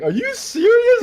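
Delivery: very high, squeaky mock disbelief; high comic incredulity; imitated dying laughing. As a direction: squeal it like someone cracking up. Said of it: "They just start dying laughing".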